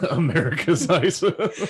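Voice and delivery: laughing it out slowly. Real, not acted. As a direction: repeat it while cracking up. Repeating the joke while laughing.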